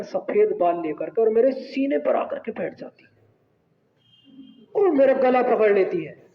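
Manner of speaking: usual speaking voice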